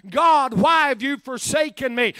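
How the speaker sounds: ordinary talk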